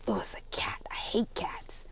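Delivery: low, hushed disgust. Retold or imitated. Best friend spotting a cat, disgusted. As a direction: recoil under your breath, soft and low. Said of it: "she's just so disgusted".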